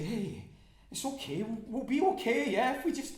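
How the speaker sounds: loud, high pleading reassurance; frantic reassurance. Acted. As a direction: reassure him through rising panic. Soothing a crying man.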